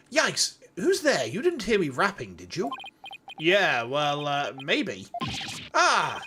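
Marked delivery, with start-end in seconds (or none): 0.1-2.7 raised posh English voice. Acted. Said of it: "a very posh English voice". 5.7-6.2 high posh English plea. Acted. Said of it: "a very posh English voice".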